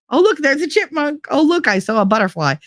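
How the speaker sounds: high, excitable mock dog voice; excited dog alert. Dogs alerting owner to every creature, mock excited. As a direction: pipe up like an easily distracted dog. Voicing easily distracted dogs.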